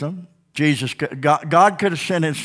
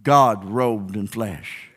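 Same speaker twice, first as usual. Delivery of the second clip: ordinary delivery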